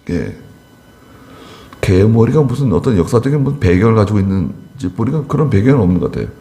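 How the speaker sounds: ordinary talk, nothing marked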